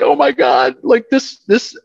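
high-pitched retold nervousness; nervous awe. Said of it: "biting our nails at that point".

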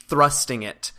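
ordinary talk

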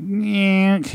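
high, drawn-out nasal-like "nyeh"; imitated noise. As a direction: mimic a long squawky noise.

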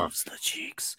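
breathy joking whisper. Pretend. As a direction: hush it like a secret. Echoing joke in whisper.

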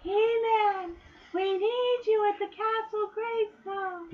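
high, slow, raspy plea; a squeaky sorceress begging for help. Retold or imitated. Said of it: "high-pitched, raspy voice".

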